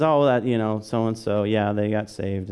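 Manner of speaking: put-on jaded, unexcited shrug of a voice; mock indifference. Churchgoer hearing someone got saved, mock indifference. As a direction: play someone who has lost all excitement. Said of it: "we often lose our excitement".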